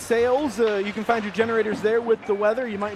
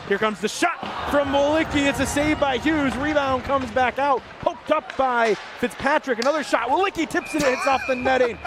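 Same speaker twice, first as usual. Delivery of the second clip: raised pitch, urgent hockey call; higher-pitched live rush of commentary; excited play-by-play; 5 semitones higher than usual. Real, not acted. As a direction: call the flurry of shots as it happens. Live call of shots on goal.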